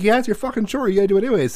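high-pitched, no-nonsense comeback; mom's retort. Retold or imitated. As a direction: imitate a mom firing back. Mom's retort in retold argument.

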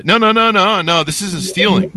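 mock denial. Mocking employee's denial.

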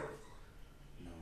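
hushed whisper to camera partner. Real, not acted. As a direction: whisper so the mic won't catch it. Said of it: "Why are you whispering?".

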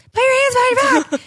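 squeaky high, fast fake police order; mock cop command.

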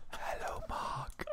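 breathy whispered hello; seductive whisper. Pretend. Pretending to whisper sweet nothings to Mark, in a whisper. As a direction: whisper it right into his ear. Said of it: "just pull back your hair and go".

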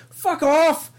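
hammed-up angry Irish dad; Irish accent. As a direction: tell them off in a thick Irish accent. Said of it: "doing all these different Irish accents".

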